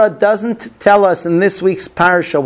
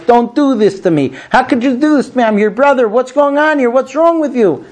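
higher, imploring plea, lightly acted; pleading brother; 7 semitones higher than usual. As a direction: beg the brothers to spare you. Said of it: "he was begging us".

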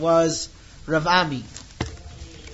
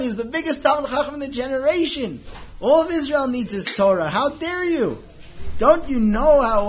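high, loud furious scolding; angry yelling; 8 semitones higher, 7 dB louder than usual. Retold or imitated. Reish Lakish yelling at the bandits over stolen money, furious. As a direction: tear into the bandits. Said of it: "he started yelling at them".

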